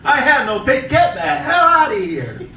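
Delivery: breaking up laughing, slow and drawn out; can't finish the line for laughing; laughing repeat. Real, not acted. Comic repeating his put-down to the customer, laughing through it.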